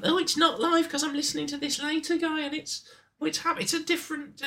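high squeaky mock voice; squeaky put-on voice; silly high voice. Mock self-reply in silly voice.

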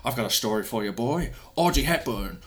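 lightly voiced Scottish gravel; a hint of a sly old Scot; Sean Connery voice. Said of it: "Sean Connery says".

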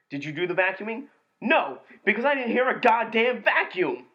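snappy reply rising to a high, loud retort; quoting the movie. Acted. Movie quote war, reciting a bickering exchange loudly. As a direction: fire the line back like an annoyed roommate. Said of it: "I'm gonna quote the movie".

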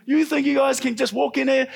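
high-pitched indignant mock scolding; imitated angry rant. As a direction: act out him going off. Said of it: "he's going off".

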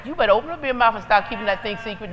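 normal talking voice

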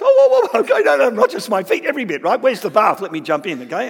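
comic excited protest; mock flustered Peter. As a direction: play Peter scrambling to be washed all over. Peter's eager overreaction retold.

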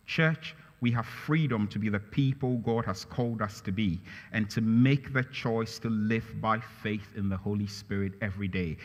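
ordinary delivery

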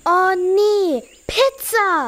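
high, fed-up groan at the food; annoyed groan. Acted. Girl groaning at the pizza, annoyed and high. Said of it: "verzogen sie genervt den Mund und stöhnten".

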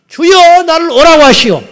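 high, loud desperate call across the water; Peter calling out. Retold or imitated. Peter shouting from the boat to Jesus, loud and urgent. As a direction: cry out to the Lord with all your voice.

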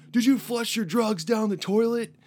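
loud, high, drawn-out interrogation; mock accusing shout. Border guard accusing a traveller of flushing drugs, mock shout.

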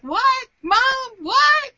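mock-shocked kid asking his mom; son's baffled. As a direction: squeak out a stunned teen's disbelief. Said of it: "And he was like".

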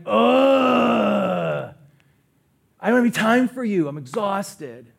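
loud, fast, impatient groan of a brush-off that sinks into tired; exhausted brush-off. Retold or imitated. Pastor mocking himself brushing off a church member, annoyed. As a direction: push the person away, then slump. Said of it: "and I'm like".

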